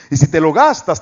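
ordinary talk, nothing marked

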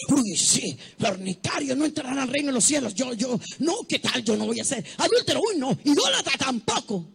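mock the smug believer's boasting; smug mockery. Mocking a self-righteous believer.

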